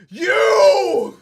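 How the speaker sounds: sudden high, very loud shout; hammed-up long holler; mock furious yell. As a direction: blast it at a total stranger. Acts out someone yelling at strangers.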